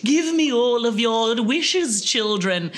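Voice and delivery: lightly voiced pantomime witch; hammy snow witch summoning the kids. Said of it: "snow witch or whatever she was called would go".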